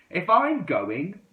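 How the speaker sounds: loud, high and slow shout. A narrator's reading. The friends splitting up, shouting angrily. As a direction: yell it as you storm off. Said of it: "they all shouted".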